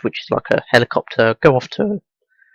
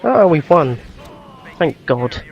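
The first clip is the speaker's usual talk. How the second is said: relieved excitement; 4 semitones higher, slower than usual. Real, not acted. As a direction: celebrate the win, pitched up. Victory after hectic battle.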